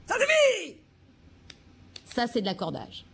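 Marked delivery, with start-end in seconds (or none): 0.1-0.8 cri beaucoup plus aigu et fort; éclatez de colère d'un coup. Shown as a demonstration. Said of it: "Je vais vous le faire pour la colère". (cri de colère très aigu)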